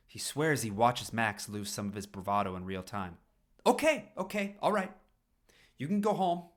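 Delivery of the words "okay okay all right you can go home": placating. Acted. Paul placating angry Max.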